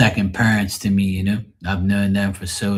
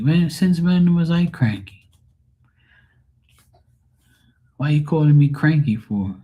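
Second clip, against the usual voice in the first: mock indignant; 7 semitones higher, 3 dB louder than usual. Real, not acted. Protesting being called cranky.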